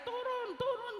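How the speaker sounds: high-pitched retold shout; mock yell. Angry man ordering the driver out of her car, mock yell. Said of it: "teriak-teriak".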